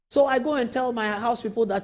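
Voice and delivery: ordinary talk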